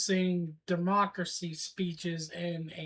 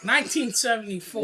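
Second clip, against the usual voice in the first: louder repeat; 4 semitones higher, 7 dB louder, faster than usual. Shown as a demonstration. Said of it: "I'm sorry, what?".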